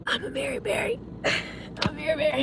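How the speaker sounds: very soft, tearful, voice breaking; choked up, barely getting the words out; quiet real tears; crying softly. Real, not acted. Tearful memory of a loved one.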